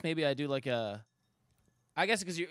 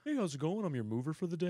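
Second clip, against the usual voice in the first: higher-pitched customer-greeting voice; client voice; 4 semitones higher, faster than usual. Retold or imitated. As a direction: imitate a boss putting on his client voice. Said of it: "that was his client voice".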